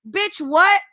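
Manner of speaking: loud incredulous outburst; outraged. Real, not acted. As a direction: shout it in disbelief. Outraged at the clip.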